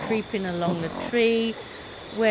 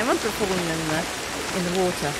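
ordinary delivery

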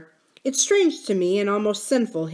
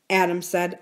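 ordinary delivery, nothing marked

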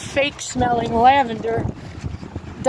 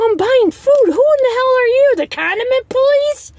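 indignant retort; 13 semitones higher than usual. Retold or imitated. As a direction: throw it back at her, offended. Retold comeback to cashier.

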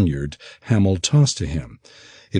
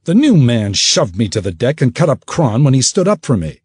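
higher and louder whining accusation; quick telltale; 6 semitones higher, 5 dB louder than usual. Acted. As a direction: blurt it out to the captain. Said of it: "Perike said quickly".

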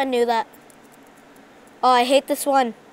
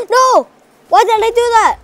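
frustrated yell; 9 semitones higher, 9 dB louder than usual. Real, not acted. Loses the game, yells.

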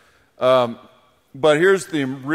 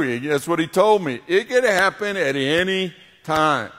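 ordinary talk

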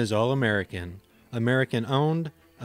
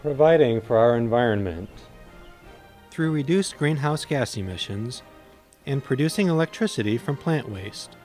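hard sell ad; 4 dB louder than usual. Acted.

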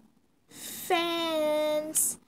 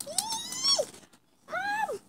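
very high shrieking call; fangirl shriek; 4 dB quieter than usual. Acted. The fan shrieks and calls her mom.

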